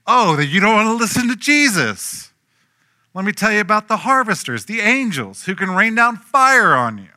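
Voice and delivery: high, loud, puffed-up threat; threatening boast. Retold or imitated. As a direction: threaten them like a hothead disciple calling down fire. Disciples threatening the Samaritan town.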